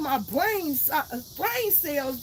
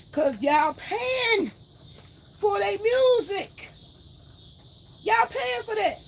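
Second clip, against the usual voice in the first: really fed up, letting it fly; angry rant; 6 semitones higher than usual. Real, not acted. Ranting at men who ask her for sexy talk, raised and angry. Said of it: "I'm just ranting right now".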